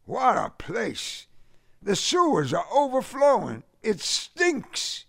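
high Southern grousing; complaining. A narrator's reading. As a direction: grouse high, dragging out the exclamation. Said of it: "he complained".

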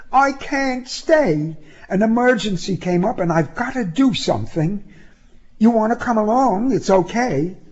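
put-on high-pitched young woman explaining an emergency; high, urgent woman's voice; Sybil, apologetic.

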